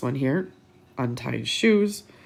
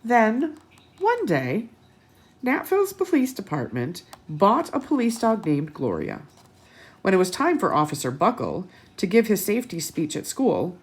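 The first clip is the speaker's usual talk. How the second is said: put-on lively read-aloud to children; bright picture-book narration; storybook reading; 3 semitones higher than usual. A narrator's reading.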